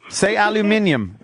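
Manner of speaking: aluminium with five syllables, al-yoo-MIN-ee-um; British aluminium. Shown as a demonstration. American host mocking the British pronunciation. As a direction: say aluminium the English way. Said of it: "Let me hear you say aluminium".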